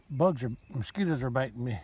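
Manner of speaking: usual speaking voice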